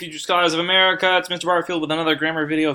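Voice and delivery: high, loud, fast hype greeting; energetic video intro. Acted. Teacher greeting his students, loud upbeat hype intro. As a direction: pump up the class like a show host.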